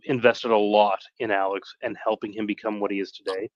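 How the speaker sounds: ordinary delivery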